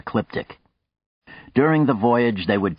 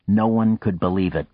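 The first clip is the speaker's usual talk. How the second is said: ordinary delivery, nothing marked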